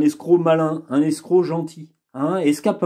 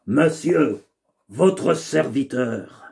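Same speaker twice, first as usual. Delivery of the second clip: low, slow servant's bow; sly valet voice; 3 semitones lower, slower than usual. Acted. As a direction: play the smooth cunning valet. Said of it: "je lui ai donné une voix un peu particulière".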